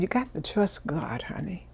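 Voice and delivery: hushed, tender words to a crying customer; tender reassurance. Retold or imitated. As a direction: soothe her gently, letting the voice drop away. Comforting a crying woman.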